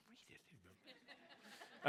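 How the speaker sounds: laughing reply. Retold or imitated. His curt reply to people.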